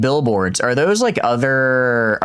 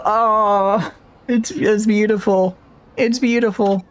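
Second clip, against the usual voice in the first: high, slow words through real laughter; laughing; 8 semitones higher, slower than usual. Real, not acted. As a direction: squeeze the words out while still cracking up. Still laughing.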